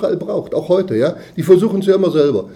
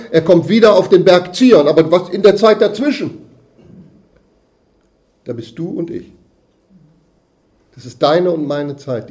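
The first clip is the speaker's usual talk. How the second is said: ordinary delivery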